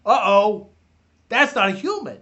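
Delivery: lightly voiced old man; a hint of Stan Lee; Stan Lee impression. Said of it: "That was me doing Stan Lee".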